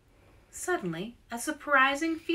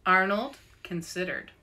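ordinary delivery, nothing marked

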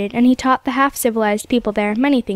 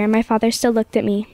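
usual speaking voice

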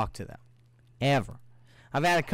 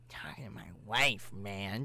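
lower, softer mock of the guy's dismissive line; mocking his tone; 6 semitones lower, 8 dB quieter than usual. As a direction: sneer it back the way he said it. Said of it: "Just the way he said it".

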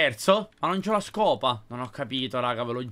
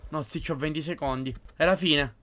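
ordinary talk, nothing marked

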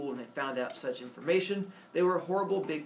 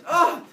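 loud, high frustrated "ah"; short burst of exasperation; exasperated yell; 13 semitones higher, 12 dB louder than usual. Acted. Father fed up with his son, exasperated cry.

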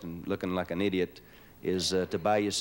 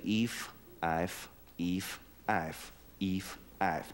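low eef eif chant; 2 semitones lower, 5 dB quieter, much slower than usual. Shown as a demonstration. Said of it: "all you have to do is say".

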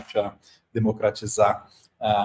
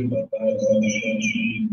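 cathedral echo; much slower than usual. Real, not acted. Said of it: "já tiramos o eco".

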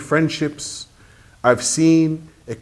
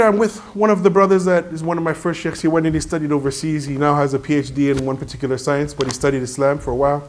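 usual speaking voice

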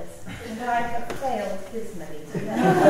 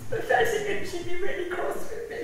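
higher, rushed embarrassed laugh; laughing through tears; 7 semitones higher, 10 dB louder than usual. Real, not acted. Eulogist laughing at herself after breaking down, teary laugh.

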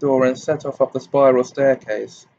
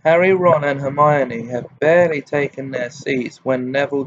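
ordinary delivery

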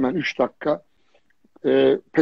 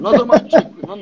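laughing disbelief; 4 semitones higher, 7 dB louder than usual. Real, not acted. Exasperated, laughing at the poser.

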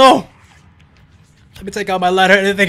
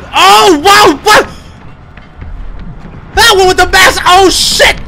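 shocked outburst, pitched way up; startled alarm; 9 semitones higher, slower than usual. Real, not acted. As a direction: blurt it out as if ambushed. Startled by attackers in a game.

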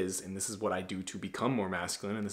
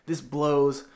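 higher, mock-annoyed grumbling coworker; pissed coworker whine; 5 semitones higher, 4 dB louder than usual. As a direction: whine it like a fed-up worker stuck an extra hour. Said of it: "everyone was, like, getting all pissed".

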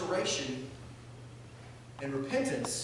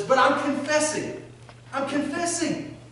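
higher, slow whiny protest; pleading younger brother; 8 semitones higher, 4 dB louder, slower than usual. Retold or imitated. Younger brother protesting to older brother, higher whiny plea. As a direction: whine it like a caught kid.

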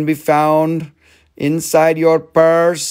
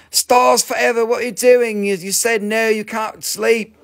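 calling out; 6 semitones higher than usual. Acted. Calling out a viewer.

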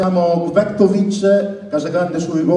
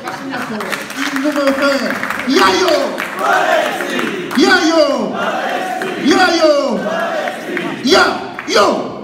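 stadium announcer shouting the scorer; high drawn-out name calls; breathless italian goal commentary; 4 semitones higher, slower than usual. Shown as a demonstration. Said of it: "Cosa dicevi?".

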